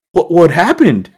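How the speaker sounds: high, loud startled question; imitated alarmed principal. Principal voiced seeing his injury.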